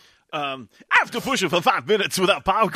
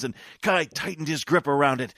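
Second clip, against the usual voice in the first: ordinary talk, nothing marked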